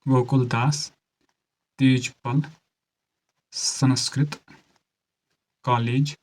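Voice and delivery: one word at a time, long pauses; deliberate note-taking pace; speaking slowly. Shown as a demonstration. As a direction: dictate slowly so students can write. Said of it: "धीरे से बोल रहा हूँ".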